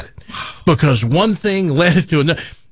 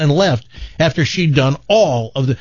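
ordinary talk

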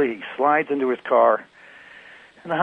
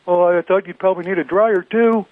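proud dim Billy; 6 semitones higher, 5 dB louder than usual. Acted. Billy's punchline about the dryer.

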